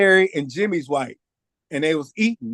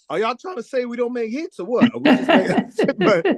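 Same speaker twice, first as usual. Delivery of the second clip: joking challenge; 6 semitones higher than usual. Real, not acted.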